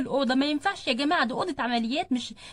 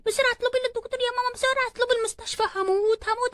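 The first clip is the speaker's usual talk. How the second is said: high-pitched, urgent wail for help; panicked labour cry; 6 semitones higher than usual. Acted. Woman in labour begging mama for a doctor, panicked. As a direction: cry out through labour pains. Labour pains, calling mother.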